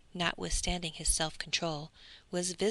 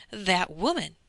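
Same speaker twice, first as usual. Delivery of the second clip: second cry louder and rising in alarm; louder shocked repeat; 5 dB louder than usual. A narrator's reading. Bertuccio to the count, alarmed and louder.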